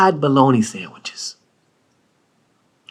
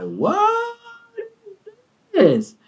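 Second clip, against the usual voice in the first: high squeak; 4 dB quieter, much slower than usual. Retold or imitated. Baffled reaction.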